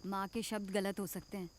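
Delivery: hushed motherly explanation; soft reassurance. Acted. Mother answers daughter's teasing, soft and gentle. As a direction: say it gently, very softly.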